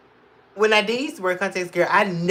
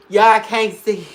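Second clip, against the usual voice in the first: usual speaking voice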